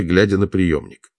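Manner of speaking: ordinary delivery, nothing marked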